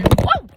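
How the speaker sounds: high, loud open-mouthed yell; angry release shout. Shown as a demonstration. Showing viewers her anger scream, a loud open yell. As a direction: let the anger blast out in one cry. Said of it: "That's how angry I am".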